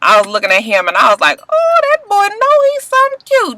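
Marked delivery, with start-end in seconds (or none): 1.5-3.6 swooning. Retold or imitated. Quoting her own admiring reaction.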